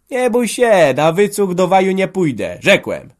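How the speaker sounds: loud, high, dropping on the last word; defiant shout, closing with finality; angry refusal. Acted. Father refuses to live on his children's charity, angrily.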